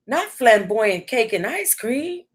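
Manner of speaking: ordinary talk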